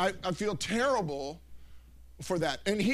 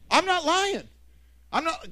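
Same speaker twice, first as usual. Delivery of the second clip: loud, high insistence; emphatic protest; 9 semitones higher, 6 dB louder than usual. Real, not acted. Preacher to congregation, loud insistent denial. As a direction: swear to it, voice up and loud. Insisting on his own story.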